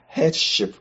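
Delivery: usual speaking voice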